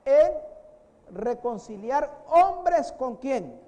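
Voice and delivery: loud, high, slow pulpit proclamation; fiery sermon climax; preaching at full force. Acted. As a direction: hammer each phrase home to the church.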